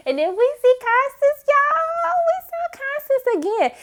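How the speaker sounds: high excited squeal. Real, not acted. Viewer thrilled Constance is back, high excited. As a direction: squeal with delight. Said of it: "excited at seeing Constance".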